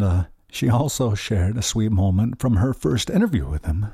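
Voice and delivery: ordinary talk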